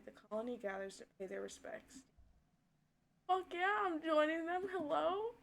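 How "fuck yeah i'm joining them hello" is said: high, loud sarcastic enthusiasm; mock cheer. Real, not acted. Player joking through the grief. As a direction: play at being thrilled. Mocking excitement at the funeral.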